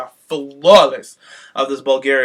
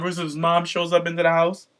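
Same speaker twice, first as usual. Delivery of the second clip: ordinary talk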